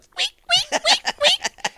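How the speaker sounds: very high squeaky imitation; toy squeak. Mimicking a toy for the co-host, shrill squeaking. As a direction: squeak like a squeezed dog toy. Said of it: "it squeaks. It goes".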